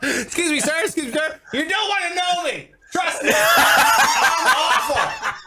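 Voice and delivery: high, laughing mock apology; mock pleading. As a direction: beg the old man, half laughing.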